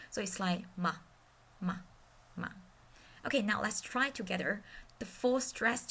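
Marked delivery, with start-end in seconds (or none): 0.8-2.5 MA very short and light; neutral tone. Shown as a demonstration. Teacher drills tone five, quick and gentle. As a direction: let "ma" go quickly, almost like a whisper. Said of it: "Tone five is more like a whisper".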